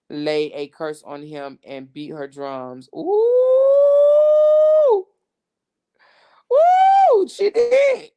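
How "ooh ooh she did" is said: loud, high squeal of shock; shocked ooh. Real, not acted. Host squeals at the news of Cora's curse, high and loud. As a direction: shriek it like juicy gossip just landed.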